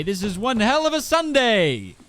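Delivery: loud, high announcer bellow; sports announcer voice. Pretend. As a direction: belt it like a hyped sports announcer. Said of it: "Sports announcer ass voice".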